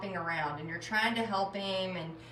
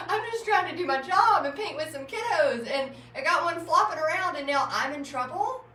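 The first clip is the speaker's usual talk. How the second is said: high, loud mock-indignant complaint; appalled protest; 7 semitones higher, 5 dB louder than usual. Teacher retelling being blamed over one kid, appalled. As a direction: plead your case in raised, incredulous tones. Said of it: "I was appalled".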